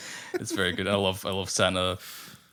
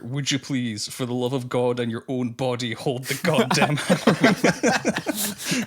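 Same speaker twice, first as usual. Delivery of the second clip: imitated exasperated outburst; 3 semitones higher, 3 dB louder, slower than usual. Quoting Bill Murray's line over background hammering, building mock exasperation. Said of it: "when he's in full on dick mode".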